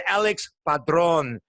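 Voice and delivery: Alex Padron in a drawn-out Spanish accent; announcer-style Spanish-accented flourish; put-on Spanish accent. Shown as a demonstration. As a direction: roll out the name slowly with a showy Spanish accent. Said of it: "we say it with an accent".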